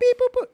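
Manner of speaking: high, loud beeping noises; robot beeps. Retold or imitated. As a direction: beep like a sound-effects man. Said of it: "Like Michael Winslow".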